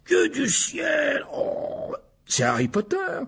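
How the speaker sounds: high, drawn-out astonished cry; startled. Acted. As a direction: gasp in amazement at the visitor. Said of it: "sursauta en voyant enfin Harry". (stupéfait, voix aiguë et traînante)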